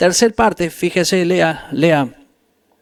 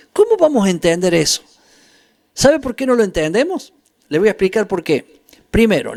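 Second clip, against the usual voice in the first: usual speaking voice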